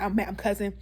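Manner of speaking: usual speaking voice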